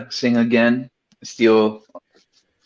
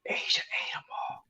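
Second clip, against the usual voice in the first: whisper; 17 dB quieter than usual. Retold or imitated. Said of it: "I wanted to be like".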